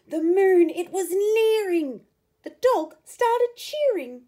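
rising excitement; excited build. A narrator's reading. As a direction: cheer it up as it nears. Climax of excited attempt.

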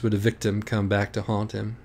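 ordinary delivery

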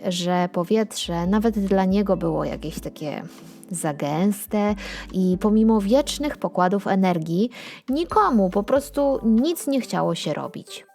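usual speaking voice

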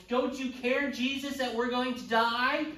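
loud, high cry of panic; frightened disciples shouting over a storm; panicked disciples. Retold or imitated. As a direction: yell it like men sure they'll drown. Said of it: "the disciples are all freaking out".

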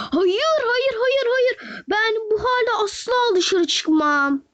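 high, wailing no, no, no, then slowly dragged out; whiny crying protest. Real, not acted. Refusing to go out barefoot.

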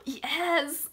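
high gasp then a bright yes; excited yes. Real, not acted. Getting the lenticular she wanted, gasping yes. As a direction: gasp and cheer.

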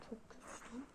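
breathless whispered complaint. Real, not acted. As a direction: groan it under your breath. Reacting to spicy taste.

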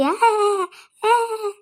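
high, loud drawn-out "aaa"; imitated ghost scare cry. Woman showing how the ghost scared her, imitated scare cry. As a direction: mimic a ghost's frightening cry. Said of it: "किती भेव दाखवला तिनं मला".

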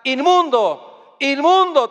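loud, high drawn-out call; slow, loud cry of "Inmundo, inmundo"; crying out unclean. Retold or imitated. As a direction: warn everyone off with the cry. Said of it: "tenían que gritar una palabra".

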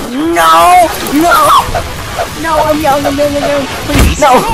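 loud, high-pitched panicked pleading; hammed-up desperate plea; desperate begging. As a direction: scream for your life. Begs for life when caught.

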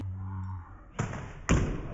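startled intake of breath; sharp gasp. Real, not acted. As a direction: gasp in shock. Net nearly hits her face.

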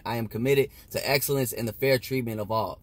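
ordinary delivery

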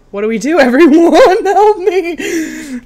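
loud, high, laughing plea; flustered cry for help; laughing panic. Real, not acted. Streamer laughing as she begs chat for help.

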